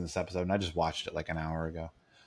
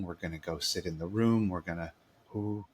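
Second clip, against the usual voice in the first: mock soothing; 4 semitones lower, 5 dB quieter than usual. Mocking relaxing classroom vibe.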